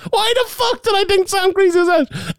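very high, incredulous rant at yourself; high-pitched what-was-I-thinking; baffled exasperation. Real, not acted. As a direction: throw up your hands, squeaky disbelief. Exasperated at own mistake.

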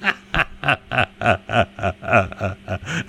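long chuckle in a steady run; real laugh. Real, not acted. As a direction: laugh helplessly at your own joke. Laughs at own joke.

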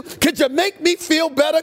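usual speaking voice

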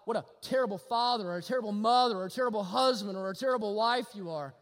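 usual speaking voice

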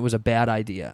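usual speaking voice

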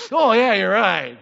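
loud, drawn-out comic reaction; loud mock agreement. Preacher acts out someone handed sour milk to smell, loud mock agreement. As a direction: blurt it slowly after sniffing sour milk.

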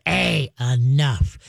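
drawn-out, low, firm; calm dog-trainer correction, very slow and low; slow deep command. Shown as a demonstration. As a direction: stretch it out slowly in a deep voice. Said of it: "deepen your voice, slow it down".

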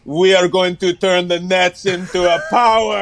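put-on Russian accent. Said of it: "And he's like".

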